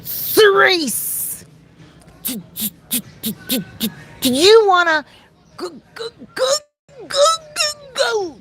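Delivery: high, broken stutter on every word; high stammering little sister; imitating stuttering sister. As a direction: mimic a child stuck on each sound. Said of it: "my sister used to stutter so badly".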